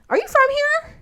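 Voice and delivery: high-pitched incredulous question. Retold or imitated. Job applicant questioning the interviewer, incredulous. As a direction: ask it way up high in disbelief.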